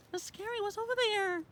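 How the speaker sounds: whisper-high to the dog; hushed high voice. Real, not acted. Owner to dog, hushed high wondering.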